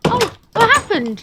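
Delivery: loud, high alarmed shout. Acted. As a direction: cry out in alarm. Panicked repeat.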